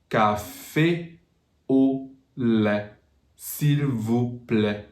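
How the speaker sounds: CAFÉ AU LAIT, S'IL VOUS PLAÎT word by word, pure vowels; slow French model with pauses between chunks; careful French pronunciation. Shown as a demonstration. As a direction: spell out each French syllable slowly, no glides. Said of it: "But in French".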